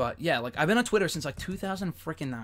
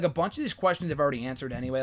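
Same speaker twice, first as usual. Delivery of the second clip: ordinary talk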